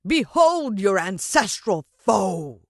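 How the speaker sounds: very slow, loud proclaiming cry; villain cry. A narrator's reading. Mister Motcher shows the ants their foe, slow and loud. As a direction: proclaim it to the swarm. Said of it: "he cried".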